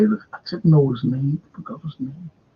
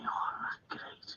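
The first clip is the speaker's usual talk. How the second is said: whispered aside; 17 dB quieter, slower than usual. Retold or imitated. Fella's quiet remark in crowd.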